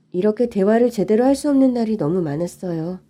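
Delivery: usual speaking voice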